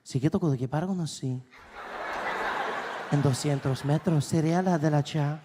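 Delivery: fast sultry Spanish navigation voice; Spanish GPS voice. Retold or imitated. GPS guiding the driver, mock seductive Spanish voice. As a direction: purr the directions in Spanish. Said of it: "She's like".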